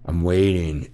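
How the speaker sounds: ordinary talk, nothing marked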